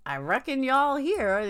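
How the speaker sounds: a joking stab at a Southern y'all; mock Southern y'all, lifted high; joking y'all drawl. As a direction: try on a Southern accent as a joke. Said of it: "but my New York accent, it doesn't fly".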